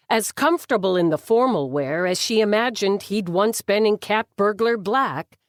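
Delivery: usual speaking voice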